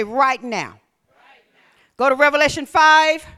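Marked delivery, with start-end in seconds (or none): 1.1-1.6 whisper. Shown as a demonstration. Preacher whispering right now back to the church, hushed. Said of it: "Somebody say".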